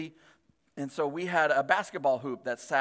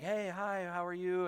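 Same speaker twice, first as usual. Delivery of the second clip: soft, polite imitated reply; quietly acted friendly hello; meek greeting; 9 dB quieter than usual. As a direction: answer the yeller gently, as the wife. Wife's meek reply to yelling neighbor.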